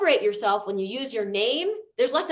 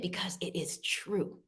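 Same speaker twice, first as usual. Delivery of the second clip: breathy whispered reassurance; 5 semitones lower, 12 dB quieter than usual. Real, not acted. Host urging the participants in a hushed whisper. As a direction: whisper it close, as if confiding. Coaching participants through affirmations.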